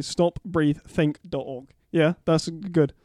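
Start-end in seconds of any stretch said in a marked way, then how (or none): 0.0-1.7 "stop breathe think dot org" said slowly, word by word; slow careful. Shown as a demonstration. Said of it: "I'll state it correctly".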